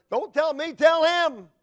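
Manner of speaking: loud, high shouted order; re-enacted stern dad at full volume; retold angry father. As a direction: shout down the pleading boy. Angry father ordering son to pray.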